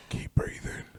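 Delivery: whispered, very soft mock encouragement. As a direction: whisper it as if to someone fading. Repeats imagined words to stabbing victim.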